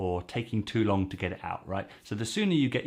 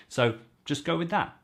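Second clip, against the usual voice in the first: ordinary delivery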